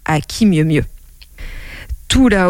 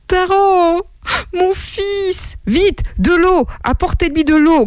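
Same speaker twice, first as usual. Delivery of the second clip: high, tearful urgent wail; distraught cry; 11 semitones higher than usual. Acted. Father over his unconscious son, distraught high cry. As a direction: cry out in alarm for your child. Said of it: "cria le père".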